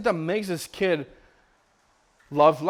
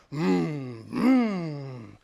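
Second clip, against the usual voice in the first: drawn-out engine vroom; imitated car engine; 3 semitones lower than usual. Father imitating the car video for his baby, humming engine revs. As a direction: imitate a car revving on a speaker. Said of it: "just sound of a car".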